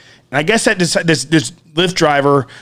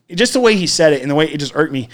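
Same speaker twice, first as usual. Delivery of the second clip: ordinary delivery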